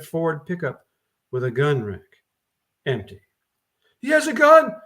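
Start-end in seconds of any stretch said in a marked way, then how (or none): 4.0-4.7 panicked high squeal, much louder. Acted. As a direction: shriek the warning in terror. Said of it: "one woman squealed".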